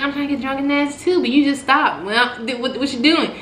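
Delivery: confused. Retold or imitated. Girlfriend confused he stopped.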